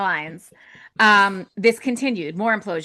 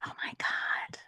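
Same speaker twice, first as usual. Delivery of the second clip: hushed, breathy disbelief; shocked whisper; 14 dB quieter than usual. Real, not acted. Interviewer reacting to the brand being shut down, shocked whisper. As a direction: whisper it under the breath, aghast.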